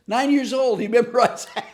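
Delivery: high, loud, breaking into laughter; amused amazement. Real, not acted. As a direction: marvel loudly and crack up laughing. Amazed, laughing at boy's comeback.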